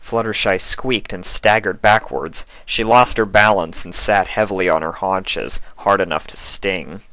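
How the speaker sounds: ordinary delivery, nothing marked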